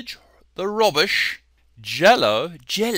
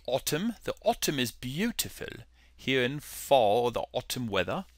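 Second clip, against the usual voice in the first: soft amateur British accent; murmured British imitation; 3 semitones lower, 9 dB quieter, faster than usual. Shown as a demonstration. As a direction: put on British English, quietly. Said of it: "give you my British accent".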